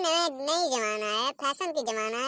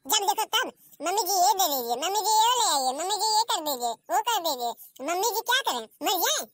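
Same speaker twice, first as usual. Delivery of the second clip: mocking mimicry; 5 semitones higher than usual. Mother-in-law mimicking daughter-in-law's endless demands, whiny. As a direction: parrot her demands in a whiny voice. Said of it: "mother-in-law mimics bahu's demands".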